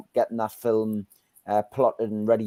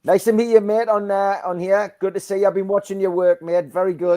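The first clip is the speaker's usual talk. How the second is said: louder, higher, beaming welcome; hearty loud greeting; 8 semitones higher, 5 dB louder than usual. Real, not acted. Host greeting the new caller on the live, louder and higher.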